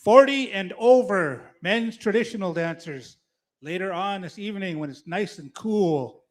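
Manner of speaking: loud arena announcer call; powwow emcee. Acted.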